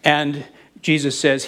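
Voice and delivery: normal talking voice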